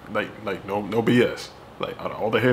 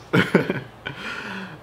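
laughing; 9 semitones higher than usual. Real, not acted. Laughing at comment story.

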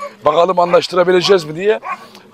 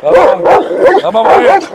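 raised, higher-pitched commands to the animals; urgent command; 4 semitones higher, 4 dB louder, slower than usual. Real, not acted. Owner calling off fighting animals, urgent command. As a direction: call them off over the commotion.

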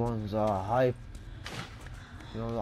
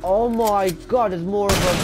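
loud, high dismay; panicked at more enemies; alarmed; 7 semitones higher, 6 dB louder than usual. Real, not acted.

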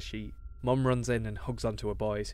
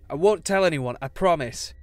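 louder, higher desperate plea; urgent begging cry; 5 semitones higher, 10 dB louder than usual. A narrator's reading. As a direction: plead loudly, like a frightened boy. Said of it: "Reese cries out".

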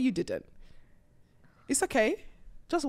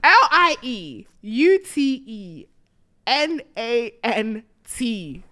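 letter by letter, very slow; deliberate spelling aloud; 6 dB louder, much slower than usual. Shown as a demonstration. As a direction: spell it out carefully for everyone. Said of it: "spells out the answer".

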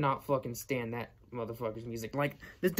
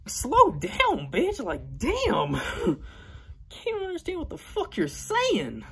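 high-pitched, strained exasperation; exasperated rant; 14 semitones higher, 3 dB louder than usual. Acted. Vlogger telling off the celebrity he can't understand, high exasperation. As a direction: plead in high-voiced disbelief, dragging out the key words. Ranting at celebrity's unintelligible talk.

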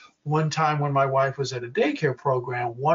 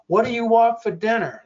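high, raised-voice question; pitched-up acted call across the din; calling over noise; 8 semitones higher, 4 dB louder than usual. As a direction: ask it over a noisy room. Asking over TV and fan noise.

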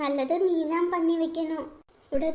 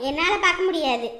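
normal talking voice

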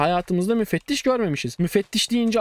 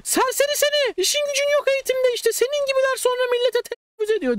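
mock hysterical teacher yelling at students; shrill teacher imitation. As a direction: screech at the class like the hated teacher. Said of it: "Bağırıyor avazı çıktığı kadar".

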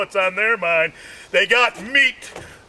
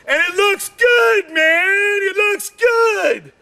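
excited boast; 11 semitones higher, slower than usual. Retold or imitated. Telling the hairdresser his hair looks good, excited. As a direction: brag about the hair.

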